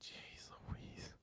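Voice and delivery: whispered exasperated Jeez Louise. Real, not acted. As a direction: breathe it out, stunned. Reacting to busy schedule.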